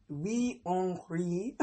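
slow, failed French accent on Wee Henri. Shown as a demonstration. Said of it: "my French accent just went to trash".

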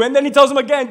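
ordinary talk, nothing marked

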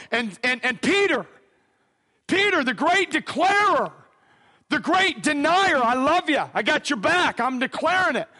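high, driving sermon delivery; impassioned preaching. Real, not acted. Preacher builds Peter's dash to shore, high and forceful.

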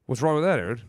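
ordinary talk

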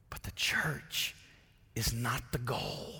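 slow, hushed whisper of a warning; whispered aside. Acted. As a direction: lean in and whisper it slowly, like a secret. Preacher drops to whisper.

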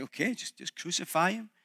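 soft, low, giving in; a quiet brush-off, lightly acted; resigned Pilate. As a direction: give in quietly, just wanting some peace. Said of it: "He's like".

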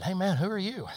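lightly acted curious stranger, pitch lifted. Fellow greets a stranger in the street, curious.

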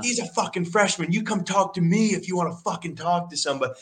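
rapid worked-up challenge; fired-up warning. Retold or imitated. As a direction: stand up for the freshman. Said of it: "I'm like".

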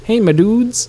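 high, loud, drawn-out show greeting; imitated intro greeting. Host teasing the co-host's intro, mimicked high and loud. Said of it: "during your intro of".

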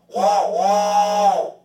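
loud, high train whistle; train whistle imitation. Shown as a demonstration. Showing off a train whistle to the host, high loud hoot. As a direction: blow a big train whistle with the voice. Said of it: "here's my train whistle".

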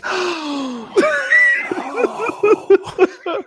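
high laughing gasp; mock horrified gasp; shocked gasp. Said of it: "the girl's face was like".

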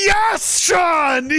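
thrilled shout of approval; excited cheer. Real, not acted. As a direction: cheer like your fighter won. Cheering the fighter.

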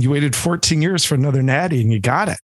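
normal talking voice

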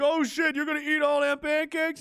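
high, loud, astonished bystander voice; mock amazed onlooker. Onlooker marvels at Kenney's pancakes, high and astonished. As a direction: gawp at the pile of pancakes. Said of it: "this guy's like".

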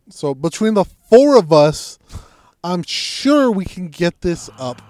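loud, slow, high-pitched declaration; emphatic rallying. Retold or imitated. Storyteller rallying the four of them to lift, slow and loud. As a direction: spell it out slowly and forcefully.

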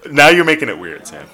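loud, laughing complaint; loud protest. Real, not acted. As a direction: push back loudly, amused. Teasing co-host, loud laughing protest.

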